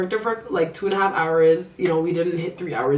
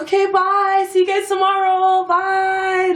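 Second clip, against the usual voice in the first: high, loud singsong farewell; singsong fake goodbye; 13 semitones higher, 4 dB louder, slower than usual. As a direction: sing the goodbye, overly cheery, to sneak out. Waving goodbye, escaping.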